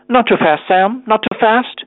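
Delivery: high, anxious little plea; nervous pleading. A narrator's reading. Unsteady skater pleads with helper.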